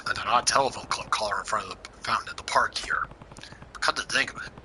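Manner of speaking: hushed whisper to himself. Acted. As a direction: whisper it under your breath. Waiting alone, talking to himself.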